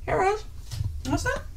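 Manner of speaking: high, soft coaxing; soft dog talk. Real, not acted. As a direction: coax the dog gently.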